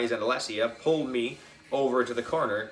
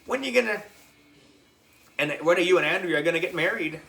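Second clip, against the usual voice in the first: teasing; 7 semitones higher, much faster than usual. A narrator's reading. Michael teasing about marriage.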